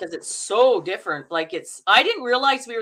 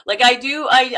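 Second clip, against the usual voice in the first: normal talking voice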